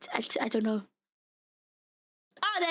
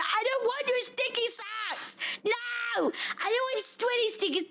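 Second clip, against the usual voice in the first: mock disgusted whine; 8 semitones higher than usual. Mock disgust at offered sweaty socks.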